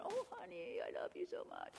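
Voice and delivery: hushed, syrupy fake tenderness; mock sweet abuser. Abuser sweet-talking his wife after yelling, hushed and syrupy. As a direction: coo it like a manipulative husband. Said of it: "he yells at her and then he hugs her".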